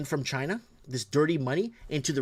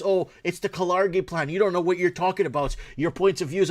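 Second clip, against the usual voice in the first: mocking critics; 6 semitones higher, 6 dB louder than usual. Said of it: "They wanna blame everything else".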